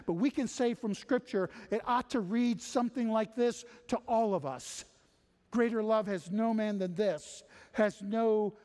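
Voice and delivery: high, loud, driving sermon voice; full-force preaching. Acted. Preacher urging the congregation that God moves first, full force.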